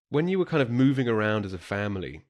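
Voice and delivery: ordinary talk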